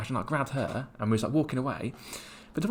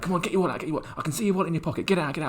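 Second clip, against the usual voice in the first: higher, insistent street hustler demanding; imitated pushy scammer; 8 semitones higher, much faster than usual. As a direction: mimic a scammer badgering for the wallet.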